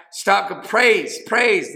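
crying out praise. Real, not acted. Preacher building to a cry.

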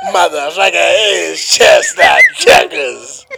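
loud mock scolding; imitating her. Louise correcting him, loud mock scolding. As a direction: bark it at him like a know-it-all. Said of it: "She'd be like".

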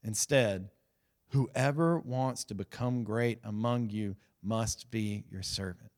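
low, soft and slow, reading Jesus' words; solemn scripture reading. Retold or imitated. Preacher reading Jesus' teaching to the congregation, hushed and slow. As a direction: let the verse land quietly and slowly. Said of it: "Watch this".

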